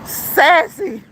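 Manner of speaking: loud, high outburst of a name; mock-scolding cry. Streamer blaming Sassy for the laughing fit, mock scolding. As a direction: call out the friend's name in tickled reproach.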